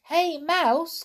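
high, loud hail; calling out. A narrator's reading. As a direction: call out to stop someone passing. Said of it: "called the sailor doll".

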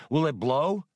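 a jittery high-pitched query; Spray's anxious question. Acted. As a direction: ask it like a nervous little alien. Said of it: "Spray asked".

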